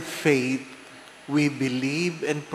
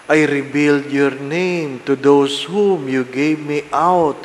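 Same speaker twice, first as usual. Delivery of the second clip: loud, solemn scripture reading; Gospel proclamation; 6 dB louder than usual. Acted. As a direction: proclaim it to the whole church.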